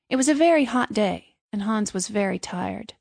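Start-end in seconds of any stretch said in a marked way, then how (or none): none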